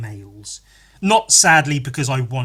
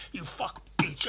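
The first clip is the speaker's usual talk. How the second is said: hissed insult; 9 dB quieter than usual. Acted. Whispered insult in rant.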